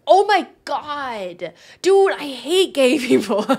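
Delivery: high, loud, drawn-out wail; mock outrage. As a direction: explode in hammed-up exasperation. Joking outburst after the romantic quote.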